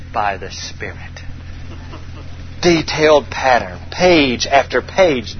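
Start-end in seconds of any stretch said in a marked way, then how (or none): 0.1-1.0 low, soft, awed reveal; whispered secret; hushed reveal. Acted. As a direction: let it drop low in reverence.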